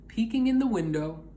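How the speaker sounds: plain reading voice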